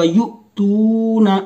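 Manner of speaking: युतूना slow with a catch before त; slow recitation with glottal catch; hamza jolt. Shown as a demonstration. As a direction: stop sharply in the throat in युतूना. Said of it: "पढ़ने में झटका होगा".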